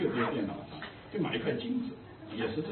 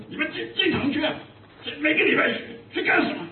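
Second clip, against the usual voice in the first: imitating an angry scold, higher and louder; 10 semitones higher, 3 dB louder than usual. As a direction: replay the pastor's angry rebuke, pitched up. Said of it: "他很生气".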